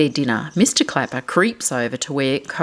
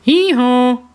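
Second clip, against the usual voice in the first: loud high hee-haw bray; loud donkey hee-haw; 8 semitones higher, 7 dB louder than usual. Acted. Donkey noise in picture book.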